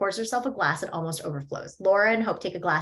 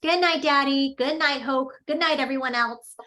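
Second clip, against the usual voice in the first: put-on higher, louder cheerful child's goodnight; little boy; 6 semitones higher, 5 dB louder than usual. Henry says good night to his family, higher and louder child voice. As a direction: chirp goodnight to everyone like a small boy.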